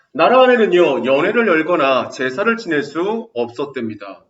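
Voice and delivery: loud, bright reading of the passage; textbook reading. Acted. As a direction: read the story out to the class. Reads textbook passage aloud. (교과서 낭독하듯 크게)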